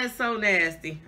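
normal talking voice

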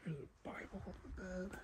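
hushed nervous whisper; nervous night vlog whisper. Real, not acted. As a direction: breathe it out so nobody hears. Hushed discovery in abandoned house.

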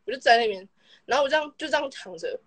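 ordinary delivery

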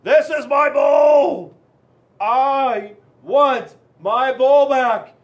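slow furious shout; a slow, outraged scream at the whole bowl. Retold or imitated. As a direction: yell it out, drawn out and furious. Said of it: "I screamed".

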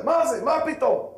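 high-pitched, heated objection; mock outraged questions; acted indignant protest. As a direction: protest as if suddenly provoked. Acts out the provoked boy protesting.

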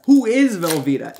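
who punched loud, falling; stress on who. Real, not acted. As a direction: hit the word who hard. Baffled joke question.

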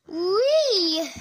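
high loud whee of joy; excited squeal. Pretend. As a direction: squeal like on a rollercoaster. Joyful driving squeal.

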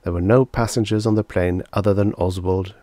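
usual speaking voice

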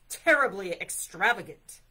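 loud disapproving bear; a little too loud. A narrator's reading. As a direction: blurt disapproval too loudly for a library. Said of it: "Bear's voice was a little loud".

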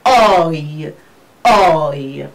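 long model Oi, twice; diphthong demo. Shown as a demonstration. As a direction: glide Oi slowly from aw into ee. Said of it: "we pronounce it as".

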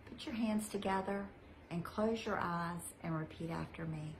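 usual speaking voice

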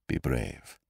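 low, soft parting word; quiet encouragement. Acted. Doctor at the door to frightened patient, low and grave. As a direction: say it low and softly, with restraint.

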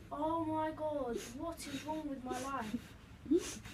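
very slow, drawn-out despair; slow despairing groan. Real, not acted. Gamer laments his bad luck slowly, half laughing. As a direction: drag it out in mock despair. Dejected after dying.

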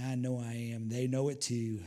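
ordinary talk

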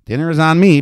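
loud, high insistence; insistent. A narrator's reading. Young man treating the madam to dinner, insisting. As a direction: insist firmly on paying. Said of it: "Peter insisted".

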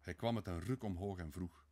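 ordinary delivery, nothing marked

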